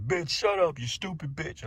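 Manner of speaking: high, sharp mock fight line; mock argument insult. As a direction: pretend to snap at her in a quarrel. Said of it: "get in an argument and be like".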